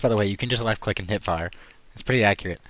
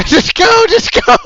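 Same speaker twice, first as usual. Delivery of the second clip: high loud laughing urging; excited yelling; 16 dB louder than usual. Real, not acted. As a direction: yell it out, much higher and louder, laughing. Laughing teammate urging push.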